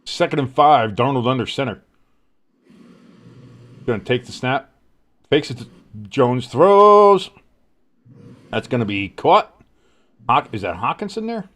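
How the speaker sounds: live sports call, rising on the throws; announcer calling the drive; play-by-play. Acted. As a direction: call the game like a broadcaster.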